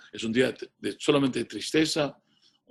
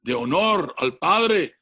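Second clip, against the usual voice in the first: higher, louder commanding shout; shouting order; 6 semitones higher, 3 dB louder than usual. Retold or imitated. Father shouting an order at his son, loud and commanding. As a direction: order him sternly. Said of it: "Y el padre le gritó".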